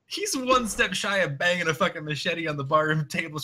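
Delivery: ordinary delivery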